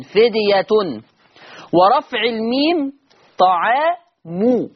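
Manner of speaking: فدية with fedyatun and طعام stretched as taʕāmu; Hafs reading. Shown as a demonstration. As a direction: recite فديةٌ طعامُ slowly with the endings voiced. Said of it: "برفع التاء مع التنوين".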